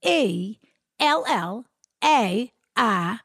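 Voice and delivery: slowly spelled letter by letter; slow spelling out. Shown as a demonstration. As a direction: spell the address out clearly. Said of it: "spelling out the web address".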